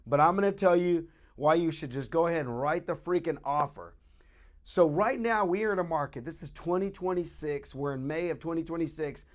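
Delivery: usual speaking voice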